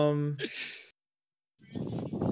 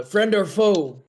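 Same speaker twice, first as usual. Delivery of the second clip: slow, louder wary call; wary challenge; 5 semitones higher, 6 dB louder, slower than usual. Acted. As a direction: demand it slowly, on guard. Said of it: "he says".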